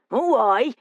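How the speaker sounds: high, scornful West Country retort; mocking West Country local; West Country accent. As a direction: jeer it in broad West Country. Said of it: "his accent pure West Country".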